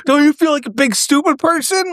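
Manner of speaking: high-pitched goofy mock taunt; dim character voice. Imitating film character mocking a woman.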